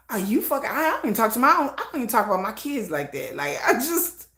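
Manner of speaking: ordinary talk, nothing marked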